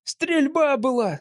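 high, slow, out of breath; panting shaky eyewitness. A narrator's reading. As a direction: gasp it out after running. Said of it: "задыхаясь".

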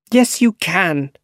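mildly angry, firm insistence. A narrator's reading. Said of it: "she said angrily".

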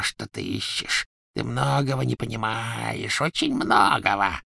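put-on slow, defiant, contemptuous old voice; scornful old man. A narrator's reading. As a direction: taunt death scornfully. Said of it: "смеялся презрительным смехом".

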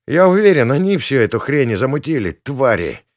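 high, loud angry accusation; indignant. A narrator's reading. Passenger blaming the enemy for the attack, indignant. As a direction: start loud and heated, easing slightly.

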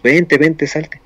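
calling out. Retold or imitated. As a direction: beckon him out of the house, fading at the end. Neighbors calling the boy out.

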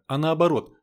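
usual speaking voice

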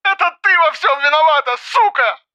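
very high hysterical screech over phone; furious yelling. A narrator's reading. Mother blames woman for missing daughter, furious yelling. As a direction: scream the accusation down the line. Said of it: "заорала Свиридова".

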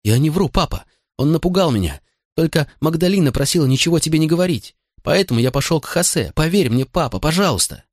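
high-voiced boy protesting to his father; pleading young son. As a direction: plead like a frightened child.

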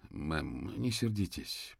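soft, slow soothing; gently calm an angry mother down; quiet, placating tone; conciliatory. A narrator's reading. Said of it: "примирительно проговорил Митчелл".

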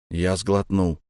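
ordinary delivery

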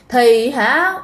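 normal talking voice

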